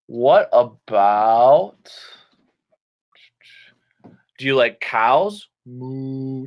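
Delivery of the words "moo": soft drawn-out moo; cow moo. Shown as a demonstration. Teacher asking about cows, soft cow imitation.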